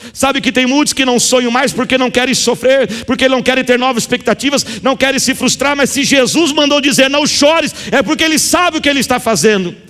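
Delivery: high, driving preacher's appeal; fervent preaching. Acted. Pastor urges the congregation to trust, fervent preaching.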